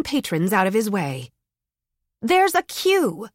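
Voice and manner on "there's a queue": higher, slow and firm; stern. Acted. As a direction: set him straight, slowly. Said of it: "Bea said sternly".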